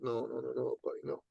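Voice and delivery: soft patient no to a friend; quiet refusal. Retold or imitated. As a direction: hush him down gently. Speaker gently stopping Dan.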